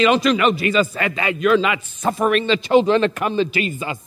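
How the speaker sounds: ordinary delivery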